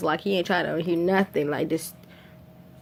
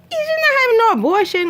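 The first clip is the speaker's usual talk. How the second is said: high, louder indignant retort; much higher indignant outburst; 4 dB louder than usual. Retold or imitated. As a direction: protest with disbelief. Bobby indignant.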